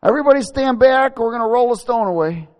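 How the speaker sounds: high, loud crowd warning; calling out. Acted. Warning bystanders as the tomb is opened, loud call. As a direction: shout it over everyone's heads.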